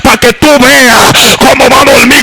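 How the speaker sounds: lightly heightened pulpit voice; fervent preaching. Acted. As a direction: preach it to the congregation. Preaching to the congregation.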